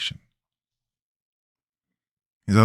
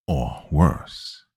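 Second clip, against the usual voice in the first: low, copied threat; mock menace; 6 semitones lower than usual. A narrator's reading. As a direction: throw his line back at him. Narrator mocks stranger.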